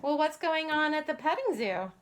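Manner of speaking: ordinary delivery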